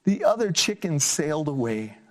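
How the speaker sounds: put-on lively, sing-song story reading for kids; reading to children. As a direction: bring the picture book alive for little listeners. Reads picture book aloud.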